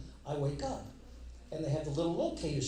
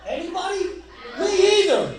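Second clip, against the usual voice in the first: pitched way up in disbelief, laughing; incredulous laughing high; 14 semitones higher, 11 dB louder than usual. Real, not acted. Comic asks the crowd, high and laughing in disbelief. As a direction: shoot up high and laugh at how absurd it is.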